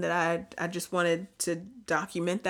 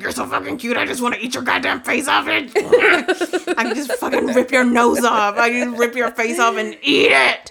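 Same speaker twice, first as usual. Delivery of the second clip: loud, higher, laughing cute aggression; aggressive cute talk; 5 semitones higher, 9 dB louder than usual. Retold or imitated. To the dogs, loud laughing cute aggression. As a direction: growl it with crazed affection. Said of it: "I speak to them really aggressively like that".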